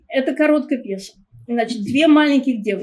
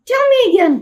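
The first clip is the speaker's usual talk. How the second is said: much higher, the younger sister pleading; little girl's voice; 11 semitones higher, 5 dB louder, much faster than usual. Shown as a demonstration. Reader corrects the mixed-up voices, little sister's high child voice. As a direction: ask it like a curious small child. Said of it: "I got the voices mixed up".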